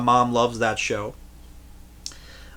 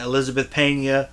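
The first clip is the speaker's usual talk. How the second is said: ordinary talk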